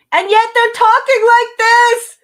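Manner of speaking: very loud, high mock shout; blaring demonstration of pointless yelling; exaggerated yelling. Shown as a demonstration. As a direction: yell it all out, way over the top. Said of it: "they just spontaneously start yelling".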